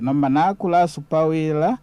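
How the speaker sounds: ordinary delivery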